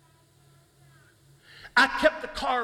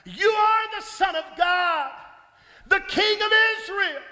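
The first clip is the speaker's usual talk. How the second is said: exclaimed; 8 semitones higher than usual. A narrator's reading. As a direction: cry it out in awe. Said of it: "Nathanael exclaimed".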